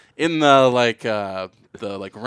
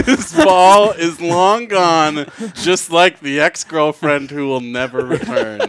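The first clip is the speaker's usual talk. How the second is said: slow, louder announcer imitation; home run call; 6 semitones higher, 7 dB louder, slower than usual. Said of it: "Is that what he said?".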